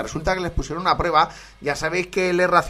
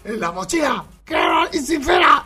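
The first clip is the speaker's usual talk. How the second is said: much higher mock shout of a party-boy catchphrase; Pocholo impression; 9 semitones higher than usual. Host previewing a famous clip, higher hammed-up shout. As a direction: yell it like the reality star. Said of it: "el de Pocholo".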